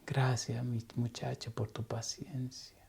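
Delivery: put-on a moved older woman's whispered gratitude; hushed thanks. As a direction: thank him barely above a breath. Moved woman thanks him softly.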